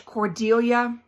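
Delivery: usual speaking voice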